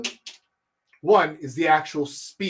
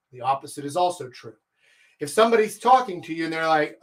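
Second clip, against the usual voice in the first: ordinary talk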